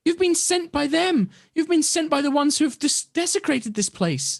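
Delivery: an offended spirit condemning intruders; unearthly voice. Acted. Spirit keeps accusing them.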